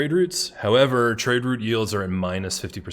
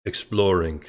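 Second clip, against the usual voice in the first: British accent; 2 semitones lower than usual. Real, not acted. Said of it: "That's such a good accent".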